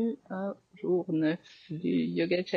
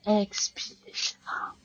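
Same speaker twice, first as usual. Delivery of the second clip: whispered breath cues; 4 semitones higher than usual. Acted. Teacher whispering breath cues as students flow.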